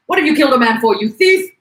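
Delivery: loud, rising shout of outrage; angry accusation. Acted. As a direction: hurl the accusation at the publican. Onlooker accuses the publican.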